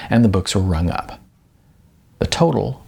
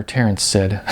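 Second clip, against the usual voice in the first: ordinary delivery, nothing marked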